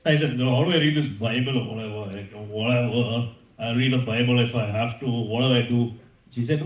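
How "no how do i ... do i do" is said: put-on much lower, offhand teen act; feigned indifference. Son hiding his Bible interest from mom, feigned indifference. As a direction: play it cool, dropping the pitch low.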